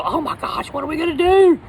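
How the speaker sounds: high-pitched panicked wail, hammed up; mock panic. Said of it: "I'm the first one to go".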